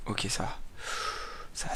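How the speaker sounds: hushed, barely voiced; soft so the house sleeps; speaking softly. Real, not acted. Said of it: "si je parle tout bas aussi".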